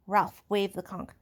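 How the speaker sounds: ordinary delivery, nothing marked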